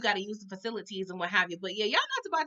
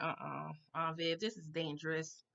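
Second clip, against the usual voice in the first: hushed warning; 7 semitones lower, 11 dB quieter than usual. Retold or imitated. Warning Aunt Viv at the screen.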